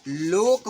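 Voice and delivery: लोक said slowly with a long ओ; slow model word. Shown as a demonstration. Teacher slowly models the ओ sound in लोक. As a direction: stretch the vowel in लोक. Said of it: "ओ pronounce करेंगे तो".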